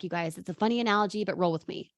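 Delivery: usual speaking voice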